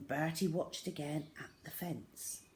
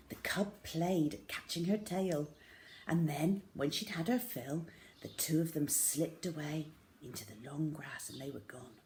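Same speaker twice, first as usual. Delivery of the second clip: ordinary delivery, nothing marked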